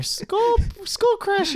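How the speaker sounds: high, loud mock battle name, breaking into laughter; hammed-up excited call. Film fan savouring Santa's hammer name, hammed-up excited call. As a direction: call it out high and gleeful, drawn toward a laugh.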